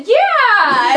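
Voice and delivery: high, loud cheer back; excited shouted reply. Retold or imitated. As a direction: shout back with gleeful enthusiasm. Acts out own reply to greeting.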